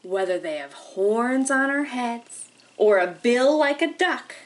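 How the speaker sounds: slow, lilting picture-book read with long pauses; reading to children. Acted. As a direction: read it like storytime for little kids. Teacher reads picture book aloud.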